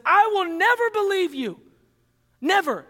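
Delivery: high, loud stubborn refusal; defiant Thomas. Acted. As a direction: hammer the word never in disbelief. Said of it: "and says".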